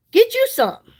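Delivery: playful nudge to buy; urging. Shown as a demonstration. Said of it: "repeated higher, more insistent".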